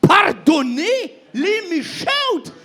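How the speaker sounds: shouted, slow and high, scandalized; shocked shout of protest; outraged disbelief. Acted. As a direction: yell it like you can't believe it. Jonas outraged at God's pardon.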